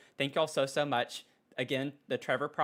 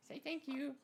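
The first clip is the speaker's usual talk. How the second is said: high, soft sing-song coo; 13 semitones higher, 10 dB quieter than usual. Real, not acted. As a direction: coo the thank-you up high. Baby talk to dog.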